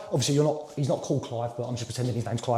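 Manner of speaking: low, soft out-of-role aside; quiet aside. Real, not acted. Trainee explaining the pretend client's name, quiet aside. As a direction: drop right down to explain on the side.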